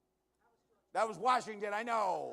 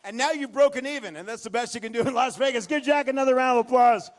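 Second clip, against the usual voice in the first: showman's finale; 8 semitones higher, 13 dB louder than usual. Real, not acted. Big finish with music.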